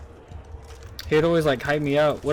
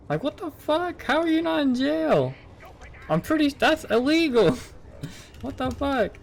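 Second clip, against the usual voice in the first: much higher, shrill-free incredulous outburst; outraged disbelief; 11 semitones higher than usual. Real, not acted. To viewers about managers drinking with teens, outraged high disbelief. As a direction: blurt it out in total disbelief, pitched way up.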